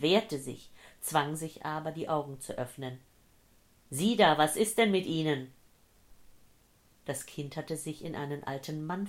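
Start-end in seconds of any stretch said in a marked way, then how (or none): 3.9-5.5 loud, alarmed call to a stranger; calling out urgently. Acted. Old man to a dazed stranger on the ground, calling out urgently. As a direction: call out to rouse someone.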